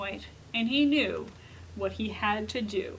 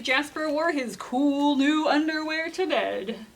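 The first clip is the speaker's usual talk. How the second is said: ordinary delivery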